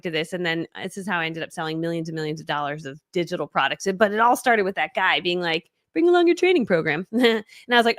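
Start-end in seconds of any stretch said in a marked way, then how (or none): 5.9-7.1 high, lifted quote of another person; imitated casual request. Retelling the guy who started it all, high imitation. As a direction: voice the other guy, pitched up.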